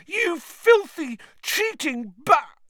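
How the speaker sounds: slow furious megaphone howl; toy megaphone. A narrator's reading. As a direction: drag out the insult, howling. Commentator keeps howling abuse.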